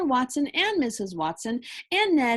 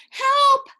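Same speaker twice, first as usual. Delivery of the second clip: high desperate yell from far off; distant shout for help; 13 semitones higher, 10 dB louder than usual. A narrator's reading. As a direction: scream for help from across the house. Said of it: "shouted a far-away voice".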